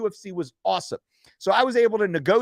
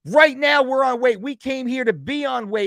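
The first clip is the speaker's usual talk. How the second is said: retold yelling; 3 semitones higher, 5 dB louder than usual. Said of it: "I'm like".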